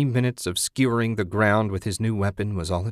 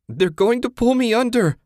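high, loud panicked cry; cried out; 14 semitones higher, 5 dB louder than usual. A narrator's reading. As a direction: cry out in terror of being dragged down. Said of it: "Susan cried".

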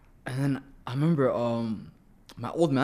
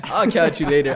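sarcastic brush-off; 5 semitones higher, 7 dB louder than usual. Retold or imitated. Mocking reply to manager.

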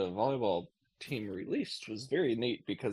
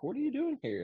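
put-on little girl voice. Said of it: "she's like".

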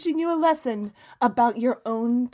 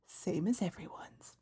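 hushed aside; 2 semitones lower, 14 dB quieter than usual. Acted. Tossed-off aside after own answer.

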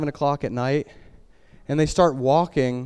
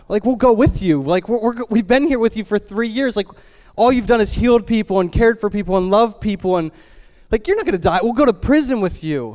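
higher-pitched, insistent disciples pleading; earnest protest; 6 semitones higher, 4 dB louder than usual. Acted. Disciples promising Jesus they'll go with him, insistent.

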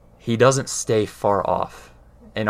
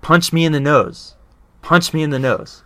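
higher and louder, daring him; mother's challenge; 6 semitones higher, 5 dB louder than usual. Retold or imitated. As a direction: throw the dare at him like his mom did. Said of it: "she looked at him and she said".

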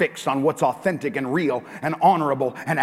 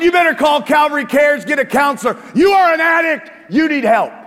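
higher, louder furious yell; retold shouting; 13 semitones higher, 11 dB louder than usual. Pastor re-enacting telling wife she needs help, shouting. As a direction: bark it at her like a desperate husband. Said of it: "I said".

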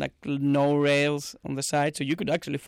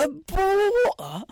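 British accent; 4 dB louder than usual. Shown as a demonstration. Showing off bottle of water for the co-host, mock British. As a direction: squawk it in a hammed-up British accent. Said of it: "Can you do a British accent".

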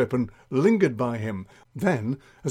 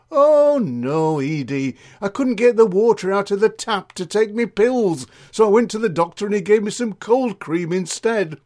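loud, higher comic explanation; gossiping Mavis, protesting; 7 semitones higher, 6 dB louder than usual. Acted. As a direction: set a friend straight with emphatic, raised voice.

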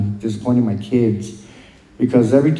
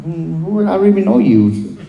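higher-pitched, puzzled pushback at a stranger; retold indignant disbelief; 8 semitones higher than usual. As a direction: act out being taken aback, pitch lifted.

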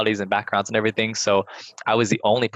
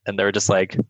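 ordinary talk, nothing marked

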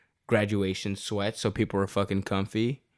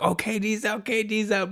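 much higher, gleeful cheering; celebrating; 11 semitones higher than usual. Retold or imitated. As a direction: crow it like the game's already won. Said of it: "we were celebrating".

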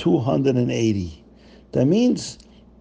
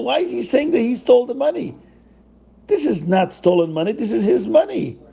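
higher, bewildered questioning; confused crowd; 8 semitones higher than usual. Acted. Townsfolk puzzling why Zusha confessed, bewildered. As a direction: puzzle it out aloud.

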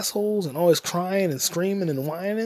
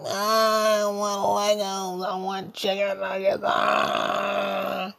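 slow, high crying whine; mock a kid sobbing over nuggets; whiny kid voice; 4 semitones higher, much slower than usual. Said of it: "wasting your amazing voice on".

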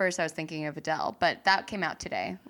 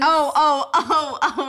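high, loud burst of recognition; excited oh; 8 semitones higher, 11 dB louder, slower than usual. Real, not acted. Friend suddenly remembering the Oscars gaffe, excited. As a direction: it just clicked, blurt it out.

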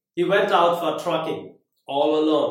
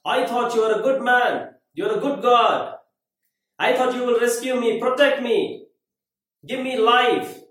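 angry shouting at God; 6 semitones higher, 4 dB louder than usual. Retold or imitated. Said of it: "he started shouting at God".

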